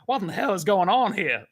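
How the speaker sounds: high, loud mock Southern accent; indignant cartoon Southerner; Henry. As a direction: burst in with a Southern exclamation. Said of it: "Henry gets m-, progressively more Southern".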